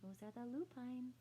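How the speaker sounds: ordinary delivery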